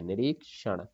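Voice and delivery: usual speaking voice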